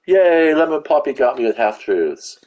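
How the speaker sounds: mock celebration, pitched well up; high-pitched fake hooray; sarcastic cheer. Real, not acted. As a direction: cheer your own defeat, long high "yay". Sarcastic cheer at being killed.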